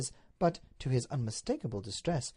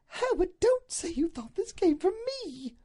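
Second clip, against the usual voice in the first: high-pitched appalled wife; emphatic Duchess; 5 dB louder than usual. Acted. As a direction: implore him with emphasis. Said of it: "She rose to her feet with emphasis".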